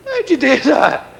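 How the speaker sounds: high, slow astonished call; amazed cry. Acted. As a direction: draw it out high in wonder.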